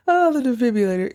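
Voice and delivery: ordinary talk, nothing marked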